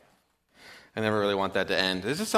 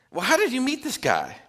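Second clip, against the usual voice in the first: much higher put-on passenger voice; curious passenger; 13 semitones higher than usual. Passenger asks the cabbie in a much higher, curious voice. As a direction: ask it with mild wonder.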